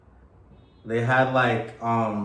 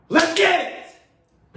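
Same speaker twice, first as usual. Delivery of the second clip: hype shout; 4 dB louder than usual. Real, not acted. Hype shout to open show.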